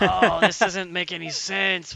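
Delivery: loud, drawn-out protest; acted exasperation. Player reacts to nonsensical double mind trick, loud exasperated complaint. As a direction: wail it slowly in mock despair.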